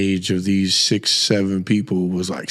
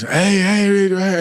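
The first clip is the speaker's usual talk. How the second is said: son yelling; 9 semitones higher, much slower than usual. Retold or imitated. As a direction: call out for dad's attention. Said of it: "he's yelling to me".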